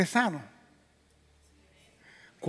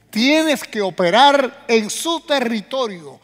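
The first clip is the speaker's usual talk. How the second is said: loud, higher, rising to a fast climax; preaching full force; 6 semitones higher, 8 dB louder than usual. Acted. Preacher hammering his point at the congregation. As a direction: preach it hard and faster at the end.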